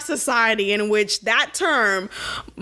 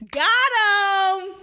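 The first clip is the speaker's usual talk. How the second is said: excited cheer; 7 semitones higher than usual. Real, not acted. Cheering Elder's comeback.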